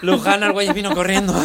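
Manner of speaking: ordinary delivery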